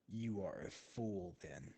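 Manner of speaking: low, very quiet dismissal; quiet contempt from a big brute; hulking, scornful figure. Acted. Game master voices narrow-eyed hulking Shuten.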